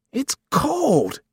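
very high-pitched protest; pained complaint. Acted. Mother to son applying ice, high complaint. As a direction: complain at the cold, pitch shooting up.

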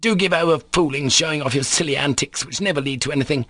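high, scolding outburst; angry shout. Acted. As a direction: scold a fool, quickening to the end. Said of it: "shouted Varvara".

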